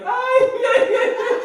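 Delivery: very high, loud Mexican mock wail; Mexican-accented cry. Comedian imitating his son's crying, Mexican-accented wail. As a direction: bawl "ay" like a crying Mexican child. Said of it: "he cries with a Mexican accent".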